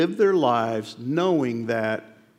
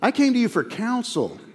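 mock indignant thought; 9 semitones higher than usual. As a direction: scoff at the advisor as an idiot. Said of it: "I was getting angrier and angrier".

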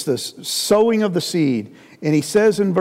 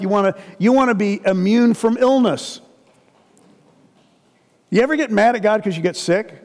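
ordinary delivery

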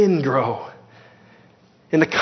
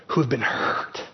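whispering; 6 semitones lower, 7 dB quieter than usual. Real, not acted. Pastor addressing hurt listeners.

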